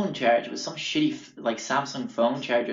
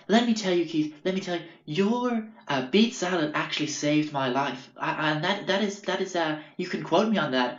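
high-pitched, put-on earnest praise; imitated effusive hippie; 5 semitones higher than usual. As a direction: gush in a raised, mock hippie voice.

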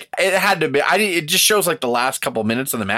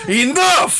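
much higher and louder, furious yell; angry shout; 12 semitones higher, 4 dB louder than usual. Retold or imitated. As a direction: stop the match with one loud shout. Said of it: "of just coming out going".

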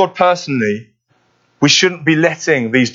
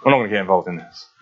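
much lower, quicker voice, muttered to himself; a giant quietly deciding to stay out of it; low, hurried retreat of a giant; Goliath backing off; 8 semitones lower, 3 dB quieter, faster than usual. Retold or imitated. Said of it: "he would have just seen God and just thought".

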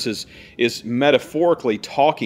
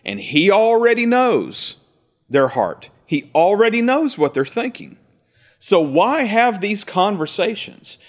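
preaching emphasis; 8 semitones higher than usual. Real, not acted.

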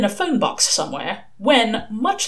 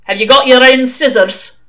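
loud, higher, brusque demand; barked question; 4 semitones higher, 10 dB louder than usual. Acted. Old witchfinder quizzing a recruit, loud bark. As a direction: bark it like a drill sergeant. Interrogator barks next question.

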